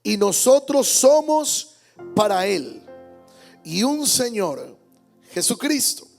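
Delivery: normal talking voice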